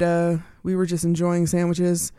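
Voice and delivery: ordinary delivery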